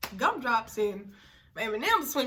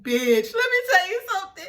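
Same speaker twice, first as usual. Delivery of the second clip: excited gossip; 8 semitones higher, 10 dB louder than usual. Retold or imitated. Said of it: "I was like".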